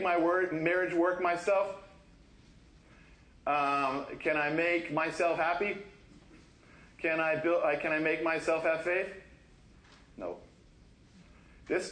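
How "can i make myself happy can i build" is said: usual speaking voice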